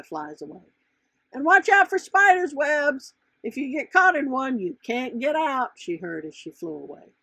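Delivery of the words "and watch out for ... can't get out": higher, louder call of warning; calling after. A narrator's reading. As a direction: call it after someone flying off. Butterfly calls warning as she leaves.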